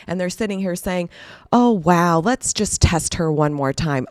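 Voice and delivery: ordinary talk, nothing marked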